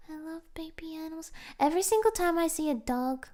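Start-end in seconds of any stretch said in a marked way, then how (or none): 0.1-1.3 cooing whisper. Real, not acted. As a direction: melt over something tiny. Cooing over baby animals.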